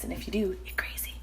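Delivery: conspiratorial whisper; whispered aside. Real, not acted. Cook teasing viewers who like dishes, playful whisper. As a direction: lean in and whisper the joke.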